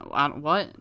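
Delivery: ordinary talk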